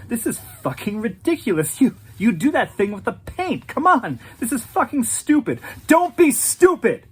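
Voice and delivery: fast, rising to a high angry yell; cornered dealer boiling over into shouting; furious rant. Acted. Patrick froths with rage.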